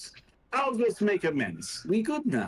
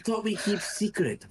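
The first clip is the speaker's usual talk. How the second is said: ordinary talk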